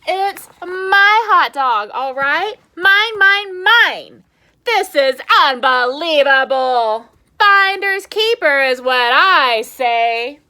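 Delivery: a cartoon pigeon's possessive rant; angry pigeon. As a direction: pigeon ranting at the duckling over his hot dog. Angry pigeon defends hot dog.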